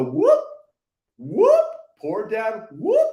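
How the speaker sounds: be silly with the milkshake cup; silly whoop. Shown as a demonstration. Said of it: "Play this out, play full out, be silly".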